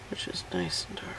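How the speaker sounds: unvoiced whisper while painting; soft whispered aside to the canvas. Real, not acted. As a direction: murmur it under your breath.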